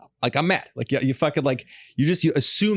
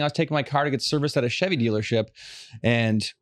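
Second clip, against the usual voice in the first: usual speaking voice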